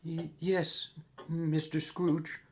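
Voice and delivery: cowed clerk's small voice; timid Cratchit. Acted. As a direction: answer the boss haltingly. Timid Cratchit answers his boss.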